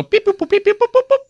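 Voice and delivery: high-pitched beeps and boops; playful spoken dial tones; imitated phone dialing. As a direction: mimic phone keypad tones with your voice.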